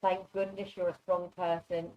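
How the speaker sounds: normal talking voice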